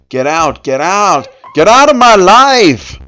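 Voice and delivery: building from firm to a full, high scream; loud yell. Acted. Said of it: "I loudly yelled".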